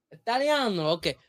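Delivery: loud, high mock Italian accent; very Italian American accent. Shown as a demonstration. Warming up for a food show audition, exaggerated Italian accent. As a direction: launch into a hammed-up Italian accent. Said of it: "you're an Italian American, very Italian American".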